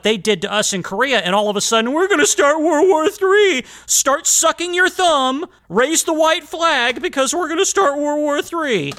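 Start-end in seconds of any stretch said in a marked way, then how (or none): 1.9-8.9 high, slow, whiny mockery of the scared; sneering impression of a frightened crowd, pitched high; mocking whiny coward. Podcaster mocking World War Three fearmongers in a high whiny voice.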